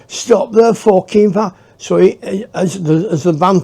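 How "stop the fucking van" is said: a higher, louder choked plea; mock strangled demand. Driver choked from behind, begging in a strained high voice. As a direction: force the words out while being choked. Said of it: "and then".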